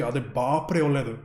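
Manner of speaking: "Ah, de bapre oledu" said slowly in Kannada; Kannada phrase. Shown as a demonstration. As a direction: draw out "Ah, de bapre oledu" with enthusiasm. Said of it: "if you wanna say it's really good, say".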